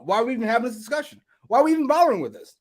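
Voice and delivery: high, fast, fed-up questioning; exasperated. Real, not acted. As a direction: fire off questions in rising exasperation. Exasperated rant about the belts.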